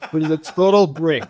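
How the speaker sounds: laughing through the words; rueful laugh at a dead phone. Real, not acted. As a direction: laugh it out in disbelief.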